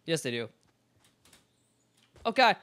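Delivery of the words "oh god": loud high startled yelp. Real, not acted. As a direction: caught off guard. Sudden scare in game.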